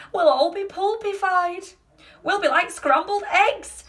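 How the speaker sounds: high, loud protest; panicked wail. A narrator's reading. Two grandmothers foresee a crash in the elevator, wailing in panic.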